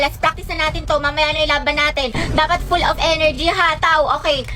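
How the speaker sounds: ordinary talk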